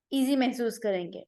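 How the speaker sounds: ordinary talk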